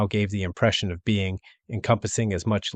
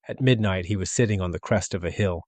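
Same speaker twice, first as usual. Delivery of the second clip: ordinary delivery, nothing marked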